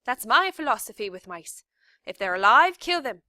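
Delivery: loud, high ranting philosophy; shouted. A narrator's reading. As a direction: bark out the rule with relish. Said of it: "shouted Cook".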